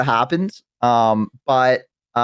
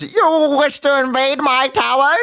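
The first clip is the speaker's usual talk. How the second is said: high, slow, hammed-up villain challenge; necromancer voice; 13 semitones higher, slower than usual. Necromancer challenging invaders of the tower, slow high villain voice. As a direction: taunt the intruder with stretched theatrical vowels. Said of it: "that's my Necromancer voice".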